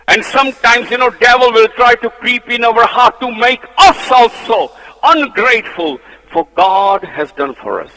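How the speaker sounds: loud, high preaching at full force; fiery preaching. Acted. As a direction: hammer the point to the congregation. Preaching warning about latter days.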